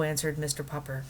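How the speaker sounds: ordinary delivery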